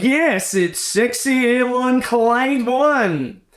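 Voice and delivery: high, slow, over-eager radio voice; annoying breakfast DJ. Retold or imitated. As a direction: drag it out like a cheesy morning DJ. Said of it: "that annoying energy about them".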